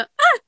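a single very high mewing "Ah"; high cat cry mirrored. Retold or imitated. Answering her cat's call, very high mew. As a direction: squeak it way up like a cat. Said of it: "I mirror him sometimes".

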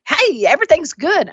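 high, bouncy voice for a dog; playful high-pitched dog impression; perky revived dog. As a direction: pop up brightly as the revived pup. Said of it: "she popped up like a piece of toast".

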